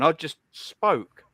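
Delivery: each word laid down slowly; slow and deliberate. Real, not acted. Streamer to chat, slowly and deliberately. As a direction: spell it out slowly, with weight.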